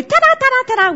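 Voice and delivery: high, rapid-fire celebratory chant; excited little kids. Retold or imitated. Little kids who love doing ta-da everywhere, excited showing off. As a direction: show off with repeated high ta-das. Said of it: "little kids learn that ta-da is great".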